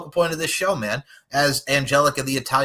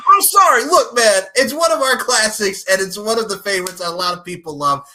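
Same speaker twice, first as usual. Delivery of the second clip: excited laughing; 7 semitones higher, 8 dB louder than usual. Real, not acted.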